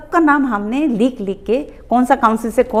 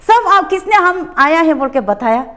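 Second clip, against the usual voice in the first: loud, higher call to all the staff; angry calling out; 6 semitones higher, 5 dB louder than usual. Retold or imitated. Calling the council staff over in anger, loudly. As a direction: summon everyone, furious. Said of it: "तो हम गुस्सा हो गया पूरा".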